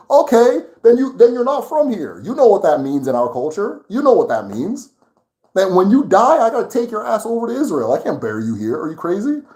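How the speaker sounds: really worked up, almost shouting. Real, not acted. Arguing with people claiming foreign origins, heated and loud. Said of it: "I'm getting very worked up about this thing".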